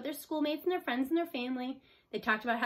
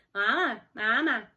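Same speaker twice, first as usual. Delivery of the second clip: peeping gosling; 5 dB louder, slower than usual. A narrator's reading. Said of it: "he peeped".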